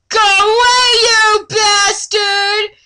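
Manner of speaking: high, loud, slow yell; mock yell. Laura yelling at the orc, high and loud. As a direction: yell it up high, dragging the insult.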